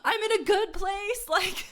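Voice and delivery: high, mock-panicked inner voice; a high cartoon ego asking what's happening. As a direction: play your ego, squeaky and lost. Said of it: "my ego was, like".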